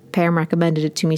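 ordinary talk

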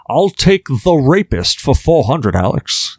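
hammed-up Scottish Connery misreading a category; bad Sean Connery. Host jokes on in a bad Scottish Sean Connery voice. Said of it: "nailed it".

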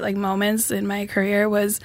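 ordinary delivery

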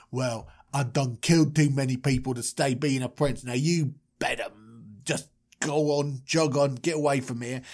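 low, brusque Moses brushing off strangers; gruff-talking Moses. Acted. Moses warning shepherds off at the well, low and dismissive. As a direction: play a dismissive tough-guy Moses, voice dropped.

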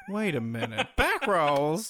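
laughing drag-queen impression; Alyssa Edwards. Joining a friend's impression, hammed-up and laughing. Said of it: "doesn't it sound like Alyssa Edwards".

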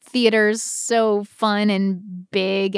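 normal talking voice

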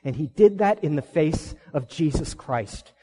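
ordinary delivery

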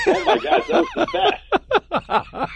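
squeaky high laugh through the words; laughing hard at the memory. Real, not acted. Laughing recalling the scene.

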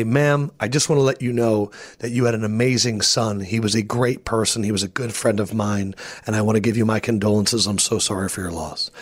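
hushed sincere sympathy; solemn condolences. Retold or imitated. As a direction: speak gently to the grieving mother. Condolences to grieving mother.

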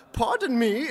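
"Pardon" blurred in a mock German accent. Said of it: "he just says to himself".

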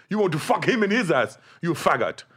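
high, loud hammed-up insult; Michael Blackson impression. Hooker's insult retold, hammed-up high and loud. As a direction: sneer it like a loud comic. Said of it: "he sounded just like Michael Blackson".

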